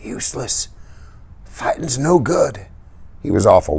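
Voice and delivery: barely breathed, slow and weak; faint dying voice. A narrator's reading. Dying Johnny to Dally, faint and weak. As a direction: let each word cost him.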